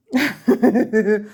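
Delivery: bright burst of real laughter. Real, not acted. Crafter laughing at her own baby-hat joke, real laughter.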